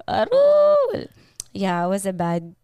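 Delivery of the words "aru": high, loud, long aru howl; howling like a wolf. Retold or imitated. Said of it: "Like that, like long".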